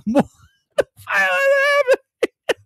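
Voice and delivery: high, slow, laughing shout of disbelief; shocked laughing yell. Retold or imitated. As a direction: yell it, cracking up in the theater. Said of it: "That's what I sounded like".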